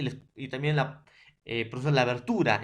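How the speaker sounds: ordinary talk, nothing marked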